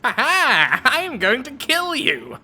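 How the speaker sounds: high-pitched, nasal villain taunt; squeaky, loud cartoon villain; Papyrus voice. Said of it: "the really high-pitched nasally voice".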